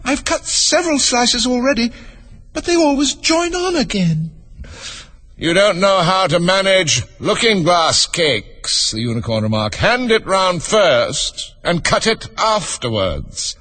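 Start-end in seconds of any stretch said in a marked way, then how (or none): 0.0-4.3 put-on very high, exasperated little girl; high-pitched complaining Alice; vexed girl. A narrator's reading. 5.4-8.9 high, slow, drawn-out remark; high, lingering storybook unicorn; superior unicorn. A narrator's reading. Unicorn lectures Alice. 9.8-13.6 high, slow, drawn-out remark; high, lingering storybook unicorn; superior unicorn. A narrator's reading. Unicorn lectures Alice.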